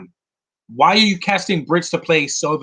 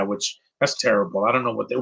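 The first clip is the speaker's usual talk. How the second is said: normal talking voice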